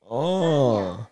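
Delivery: ordinary talk, nothing marked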